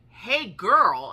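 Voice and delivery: put-on loud, high-pitched "hey, girl"; big, high mimicked holler; imitated call-out. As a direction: belt it out, imitating the child's greeting. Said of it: "she was like".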